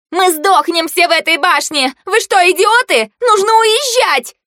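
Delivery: high, loud cry of alarm; high-pitched frantic demand to get out; panicked outburst. A narrator's reading. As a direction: cry out in panic, stretching the last words. Marsha panics, demands to leave.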